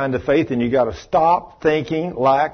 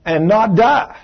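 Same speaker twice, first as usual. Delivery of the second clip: bold declaration; 5 semitones higher, 3 dB louder than usual. Shown as a demonstration. Preacher tells the church to declare it, bold and loud. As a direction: proclaim it like a vow you mean.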